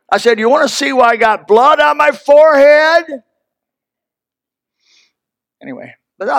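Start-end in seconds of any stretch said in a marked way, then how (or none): 0.4-3.2 high, loud comeback to the pastor; indignant retort. Retold or imitated. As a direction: fire it back, stung and defensive. Snapping back at angry pastor.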